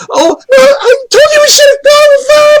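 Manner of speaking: high-pitched mock wail; hammed-up crying. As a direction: wail it out as a sobbing girl. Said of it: "starts crying".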